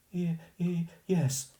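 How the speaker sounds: high, nervous stutter; timid frightened yes; stammering girl. Acted. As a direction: stumble out a scared yes.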